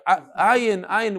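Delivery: ordinary talk, nothing marked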